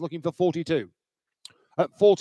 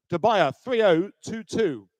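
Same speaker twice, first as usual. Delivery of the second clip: ordinary delivery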